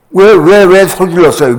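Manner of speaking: loud, insistent demand; scolding nurse. Retold or imitated. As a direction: imitate a nurse telling off a patient. Nurse demands why he shouted.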